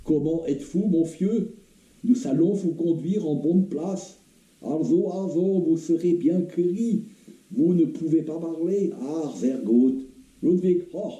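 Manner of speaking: put-on high-pitched, heavy German accent; dreadful German accent. German captor talking to the wounded soldier in bad French, thick German accent. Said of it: "avec un accent épouvantable".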